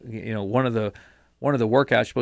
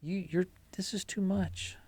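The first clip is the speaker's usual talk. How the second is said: soft, raised-pitch pleading; doubting inner voice; 5 semitones higher, 11 dB quieter, slower than usual. Acted. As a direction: imitate a small voice talking you out of it. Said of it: "that inner voice that says".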